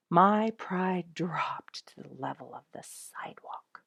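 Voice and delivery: plain reading voice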